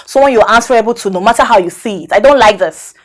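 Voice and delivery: loud, fast, rising complaint; angry. Acted. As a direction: shout her jealousy at him. Mistress angrily confronting lover.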